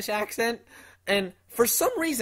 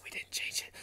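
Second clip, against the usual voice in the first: whispered aside; whispering; 16 dB quieter than usual. Acted. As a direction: confess it in a whisper. Secret aside.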